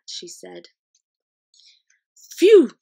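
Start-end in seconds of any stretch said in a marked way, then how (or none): 2.4-2.8 high, loud puff of relief; relieved phew. A narrator's reading. BFG after escaping the giants, loud relieved phew. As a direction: blow it out after the ordeal. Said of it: "said the B F G".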